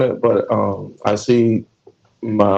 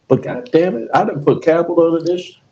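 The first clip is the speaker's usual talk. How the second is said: ordinary delivery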